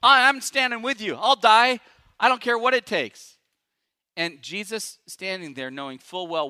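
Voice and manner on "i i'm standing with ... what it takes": loud, high chorus of agreement; eager disciples. Retold or imitated. Preacher as disciples loudly echoing Peter's vow. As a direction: pile on loudly, eager to match him. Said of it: "And they're like".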